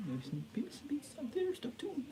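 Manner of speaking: whispered secretly, half to himself; teasing whisper. Real, not acted. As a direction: let the plans slip in a whisper. Whispered teaser of plans.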